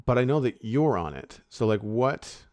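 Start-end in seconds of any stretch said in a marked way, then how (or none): none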